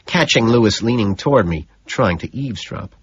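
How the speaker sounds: ordinary delivery, nothing marked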